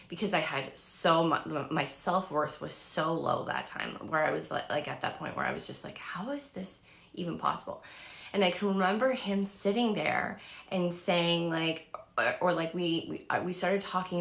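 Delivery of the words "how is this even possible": whispered disbelief; awed whisper. Retold or imitated. Thinking at the dinner table, whispered awe. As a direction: breathe it out in a whisper.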